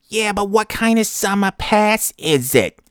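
very high, slow, hammed-up New Hampshire accent. Clerk pressing a customer about his pass, exaggerated New Hampshire accent. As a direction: stretch it out in a squealing mock New Hampshire accent. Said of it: "like, the trampoline park New Hampshire accent".

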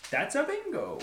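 doing Hans Landa's bingo line lightly; Hans Landa impression. Friend quoting the movie villain, light Landa impression. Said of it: "He's like".

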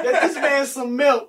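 slow laughing call. Real, not acted. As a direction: laugh while calling out. Laughing at friend.

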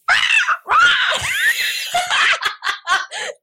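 very high, loud pterodactyl shriek; pterodactyl noise. Shown as a demonstration. Recording a voice memo to confuse Jordan, loud pterodactyl screech. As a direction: screech like a pterodactyl, breaking into laughter.

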